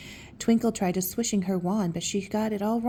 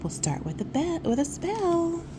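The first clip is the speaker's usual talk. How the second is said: ordinary delivery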